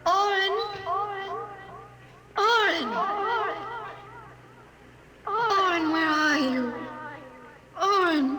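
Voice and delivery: searching call into the dark. Acted. Calling out in the tunnels.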